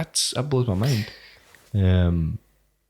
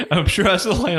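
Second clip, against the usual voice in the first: ordinary talk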